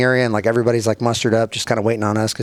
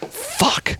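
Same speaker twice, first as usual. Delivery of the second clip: dreading curse; 4 dB quieter than usual. Retold or imitated. Victim's resigned dread, acted.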